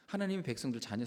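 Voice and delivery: usual speaking voice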